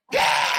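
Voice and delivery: short mock scream; imitated spider screech. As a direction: let out a sudden hammed-up yell. Spider whirls round and charges.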